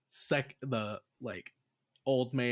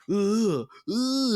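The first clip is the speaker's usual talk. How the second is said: loud, high imitated "ugh" noises; mock spider grunts; 9 semitones higher, 8 dB louder than usual. Imitating the spider waving its arms, loud high grunts. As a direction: act out the spider's taunting lunges.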